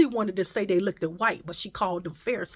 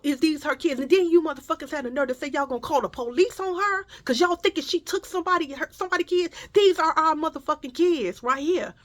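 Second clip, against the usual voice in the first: high-pitched, fast indignant challenge; indignant confrontation; 8 semitones higher than usual. Retold or imitated. Partner confronting store staff about calling police, indignant. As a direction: take them to task in a high voice. Acted angry confrontation over the kids.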